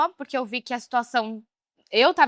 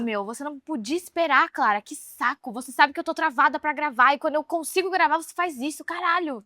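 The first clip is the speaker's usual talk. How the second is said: heated, higher-pitched exasperated scolding; re-enacted angry outburst; 5 semitones higher than usual. Scolding her sister for ruining the recording, re-enacted anger. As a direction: relive blowing up at your sister. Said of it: "Nossa, fiquei muito brava".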